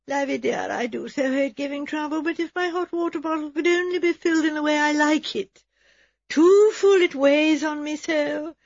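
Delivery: high, thin, weak voice; melancholy invalid. A narrator's reading. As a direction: complain plaintively like a long-suffering invalid. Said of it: "said Miss Emily in a melancholy tone".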